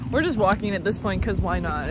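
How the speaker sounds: usual speaking voice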